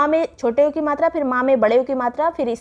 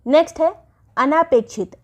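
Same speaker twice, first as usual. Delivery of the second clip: ordinary talk, nothing marked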